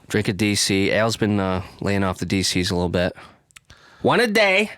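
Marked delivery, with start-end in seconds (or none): 4.0-4.7 much higher, put-on slogan voice; silly high voice. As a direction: squeak it like an ad tagline.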